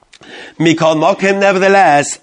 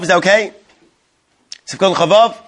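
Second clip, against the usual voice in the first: normal talking voice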